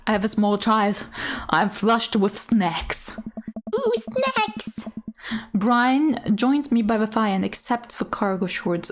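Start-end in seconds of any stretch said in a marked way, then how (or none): 3.7-4.9 high delighted squeal; excited squeal. Real, not acted. Player delighted by her snacks, high squeal. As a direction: squeal "Ooh, snacks!" with glee.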